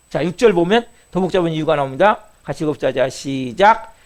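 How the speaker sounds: ordinary talk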